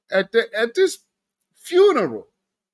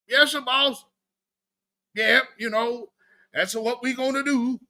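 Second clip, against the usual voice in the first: mock servile yes-man; 6 semitones higher than usual. Mocking Reverend Al's reply.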